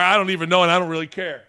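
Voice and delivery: usual speaking voice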